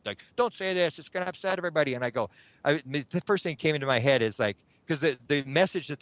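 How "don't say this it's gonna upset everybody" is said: imitating upset objectors in higher pitch; mock-worried protest, pitched up; upset pleading. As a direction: voice other people's alarmed objection. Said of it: "they were all upset".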